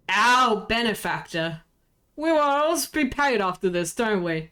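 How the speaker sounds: ordinary talk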